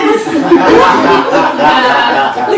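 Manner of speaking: loud hammed-up bawling; long comic boo-hoo wail; mock sobbing. Patient on the phone to clinic, mock sobbing.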